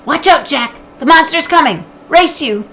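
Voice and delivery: high, bright, loud playful call; excited child call. Acted. Sister teasing her brother, excited call. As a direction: shout it like a kid racing off.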